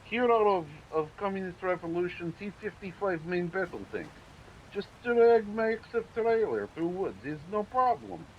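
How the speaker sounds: hammy broken-English Russian comrade; terrible Russian accent. Pretend. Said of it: "in terrible Russian accent".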